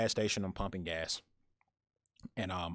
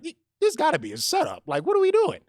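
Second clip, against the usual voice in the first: high-pitched, loud disbelief; hammed-up bewildered panic; mock panic; 9 dB louder than usual. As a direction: squeal it in comic alarm. Retold panicked inner thought.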